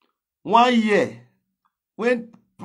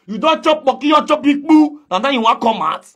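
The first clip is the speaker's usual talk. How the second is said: escalating taunt, higher and louder; louder repeat; 7 semitones higher, 6 dB louder than usual. Real, not acted.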